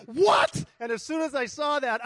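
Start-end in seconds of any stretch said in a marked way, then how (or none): 0.1-0.6 very high, loud shocked what; alarmed what. Retold or imitated. Driver seeing the engine light, shocked. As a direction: jolt at the warning light. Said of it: "I'm like".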